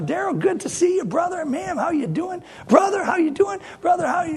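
high, gushing greetings, rising on every you; overly friendly churchgoer. Retold or imitated. Mimicking a churchgoer greeting everyone in the foyer, high and gushing. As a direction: bounce from one handshake to the next, pouring on the cheer. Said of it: "I've seen people go".

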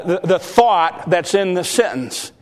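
ordinary talk

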